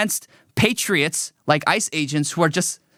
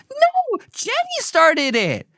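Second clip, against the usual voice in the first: put-on much higher, slow bickering child voice; whiny little kid; slower than usual. Becky bickering with Jenny, high slow whiny kid voice. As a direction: squeal it back like a toddler in a spat. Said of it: "Becky's like".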